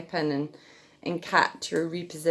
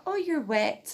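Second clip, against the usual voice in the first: much higher cooing voice; baby talk; 9 semitones higher than usual. Shown as a demonstration. Mum patting her wet baby, high baby talk. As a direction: tell the baby in a high coo. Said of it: "pat on the wet area".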